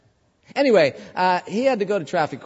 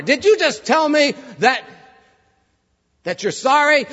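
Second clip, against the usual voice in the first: incredulous judge; 7 semitones higher than usual. Retold or imitated. Judge questions the defendant.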